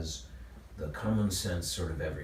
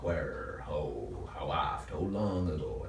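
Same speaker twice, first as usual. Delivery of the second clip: slow monosyllables; 4 semitones lower, 5 dB quieter, slower than usual. Shown as a demonstration. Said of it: "it slows you down".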